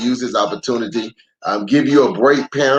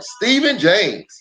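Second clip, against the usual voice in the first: title announcement; 9 semitones higher, slower than usual. Acted.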